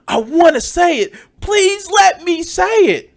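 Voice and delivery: high wailing plea, hammed up; mock desperate begging. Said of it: "like that meme of just like".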